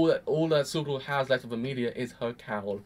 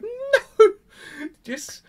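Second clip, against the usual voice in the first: mock no; 7 dB louder than usual. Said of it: "I was like".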